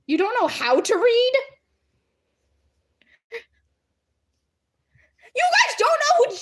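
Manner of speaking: high, incredulous squeal; mock disbelief. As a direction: climb up high in playful outrage.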